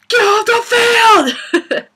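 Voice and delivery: high, drawn-out, very loud imitated scream; mock furious scream. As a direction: scream like a referee at the end of his rope. Retold referee exploding at dad.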